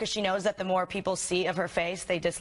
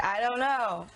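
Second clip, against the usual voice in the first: lightly mimicked evasive candidate; a hint of dodging the question; imitating Hillary; 2 semitones higher, slower than usual. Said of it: "Just her being like".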